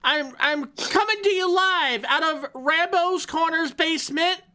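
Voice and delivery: high squeaky hamster voice. Pretend. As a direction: pitch way up like a tiny hamster. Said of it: "How can we sound like a hamster?".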